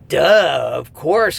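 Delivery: tossing it off as totally obvious; mock obvious duh. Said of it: "kind of a statement".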